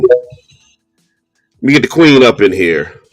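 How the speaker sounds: usual speaking voice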